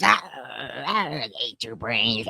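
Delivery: low, quiet zombie groaning; hammed-up zombie moan. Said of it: "Sorta sounds like zombies".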